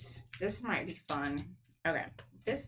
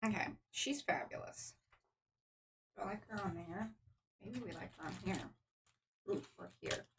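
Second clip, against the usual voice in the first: whispering; 11 dB quieter, faster than usual. Real, not acted. Muttering while arranging pieces.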